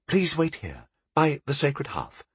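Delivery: high, soft-spoken temple novice; polite novice. A narrator's reading. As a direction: ask the guest to wait, lightly and high. Novice shows guest where to wait.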